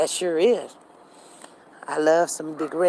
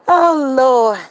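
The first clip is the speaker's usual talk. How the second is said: normal talking voice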